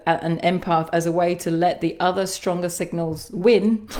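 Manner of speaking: usual speaking voice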